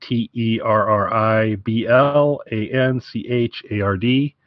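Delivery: normal talking voice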